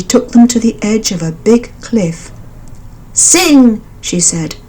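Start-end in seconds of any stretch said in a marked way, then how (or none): 3.1-3.8 high, firm order; commanding mother bird. A narrator's reading. Mother bird ordering the frogs, high and firm. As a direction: urge them on.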